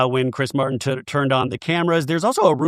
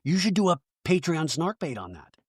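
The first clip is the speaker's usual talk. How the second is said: lightly lowered voice, not a full whisper; hushed aside; 2 semitones higher, 5 dB quieter than usual. Real, not acted. Host pitching a bonus episode idea to co-host, half-whispered. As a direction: slip into a quieter aside. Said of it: "Why am I whispering?".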